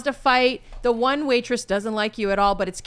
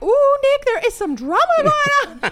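gossipy excitement; 5 dB louder than usual. Retold or imitated. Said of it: "I'm like".

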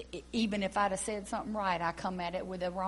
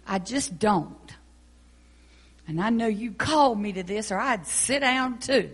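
earnest prayer; 4 dB louder than usual. Acted. Praying aloud to God.

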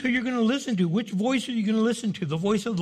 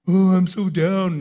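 slow, louder self-pitying moan; mock whine; 6 dB louder than usual. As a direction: ham up the sulky complaint. Mocking a discouraged whiner.

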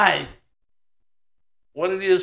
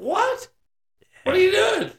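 mock baffled thinker; 14 semitones higher than usual. Mocking educated people's thoughts.

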